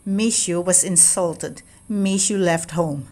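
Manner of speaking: plain reading voice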